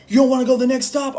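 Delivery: high, loud, fast angry challenge; imitated screaming. Driver screaming at me in the truck, imitated screaming. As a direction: mimic a raging driver's shrieked question. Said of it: "screaming at me, cussing me".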